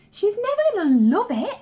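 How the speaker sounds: "never" punched high and loud, the rest following; stress on never. Real, not acted. Mother admitting to viewers the daughter won't love school, stressing never. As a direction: lean hard on "never", a big rueful lift.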